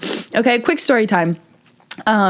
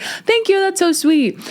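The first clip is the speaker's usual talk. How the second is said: much higher, gushing imitation; sweet thanks; 10 semitones higher than usual. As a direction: imitate her high-pitched, grateful reply. Voices the girl's grateful reply.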